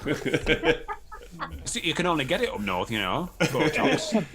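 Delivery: laughing mock northern English drawl; slow, high put-on Yorkshire voice; northern accent. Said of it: "in a northern accent".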